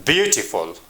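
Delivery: "beautiful" stressed on BEAU, falling after; "beautiful" as BEAU-ti-ful, a careful word demo; stress on first syllable. Shown as a demonstration. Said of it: "At the beginning".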